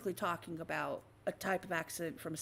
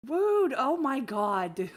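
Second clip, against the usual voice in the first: mock offended; 4 semitones higher, 7 dB louder, slower than usual. Real, not acted.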